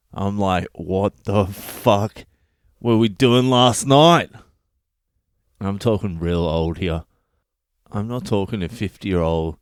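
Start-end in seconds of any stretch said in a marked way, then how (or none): 0.7-4.3 slow start swelling to a loud finish; appalled disbelief. Retold or imitated. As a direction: stretch it out, then burst out incredulous. Horrified retort to old lady.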